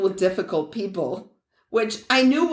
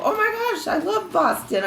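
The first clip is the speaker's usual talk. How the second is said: high-pitched, gushing enthusiasm; 11 semitones higher than usual. Retold or imitated. Telling a lady from Massachusetts about Boston, gushing. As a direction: gush it way up high, re-enacting your own excitement. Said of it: "I was telling that lady, I go".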